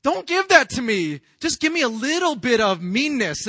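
loud, high-pitched refusal; acted exasperated pleading; mock protest. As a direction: push the mercy away, insistently. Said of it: "you're like".

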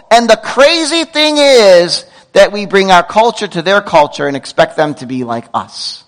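usual speaking voice

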